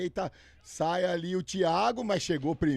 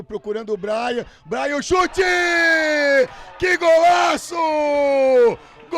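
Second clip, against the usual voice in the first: rising to a full-throated goal scream; explosive goal commentary; 10 semitones higher, 11 dB louder than usual. Real, not acted. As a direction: build and explode on the shot. Goal build-up, live commentary. (narração explosiva de gol)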